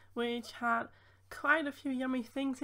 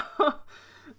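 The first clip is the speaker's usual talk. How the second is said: short laugh; 4 semitones higher, 6 dB louder than usual. Real, not acted. Laughing about getting sick.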